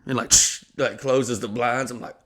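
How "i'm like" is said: ordinary talk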